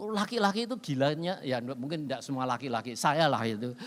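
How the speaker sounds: ordinary delivery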